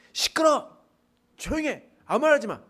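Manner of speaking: loud, high angry shout; crowd scolding. Retold or imitated. Crowd silencing the shouting beggar, loud and angry. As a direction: snap at the beggar to shut up. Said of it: "뭐라고 하겠어요".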